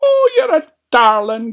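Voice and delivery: high, loud granny exclamation; old granny. A narrator's reading. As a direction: coo loudly as a high-voiced old granny. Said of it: "Granny said".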